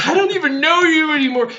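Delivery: high, hammed-up outraged disbelief; mock betrayal. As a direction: wail it like a melodramatically betrayed kid. Hammed-up betrayed child confronting mom.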